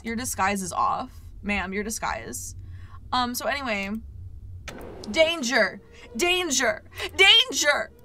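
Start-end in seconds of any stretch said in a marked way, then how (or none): 5.1-7.9 mock alarm shout.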